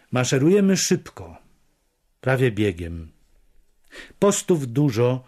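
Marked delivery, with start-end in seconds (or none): none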